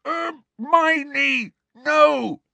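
high shout through a gag; desperate muffled protest; muffled gagged shout. A narrator's reading. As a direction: yell as if your mouth is stuffed. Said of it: "shouted Ron through his gag".

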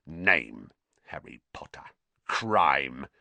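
low caretaker filling in a form; grim official dictation. A narrator's reading. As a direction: dictate each entry to yourself, pitched low.

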